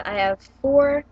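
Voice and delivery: ordinary delivery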